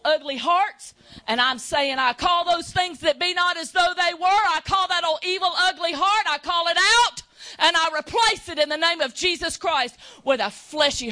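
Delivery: loud high preaching cry; fiery preaching. Acted. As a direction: preach it out at full force. Preaching at full force.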